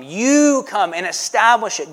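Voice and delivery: ordinary talk, nothing marked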